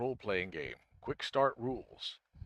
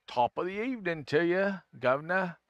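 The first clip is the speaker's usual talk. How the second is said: a hammed-up British greeting, slightly higher; mock British accent; 6 semitones higher than usual. Streamer greets a raider in mock British accent. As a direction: tip your hat in a bad British accent. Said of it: "I really don't do accents that well".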